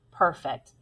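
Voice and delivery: ordinary talk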